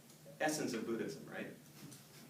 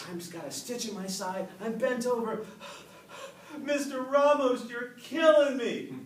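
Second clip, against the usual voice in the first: high, loud gasping complaint; winded, pleading; 8 semitones higher, 9 dB louder than usual. Acted. Red-faced boy protesting to his running teacher, winded. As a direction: gasp it out like an exhausted kid on a run.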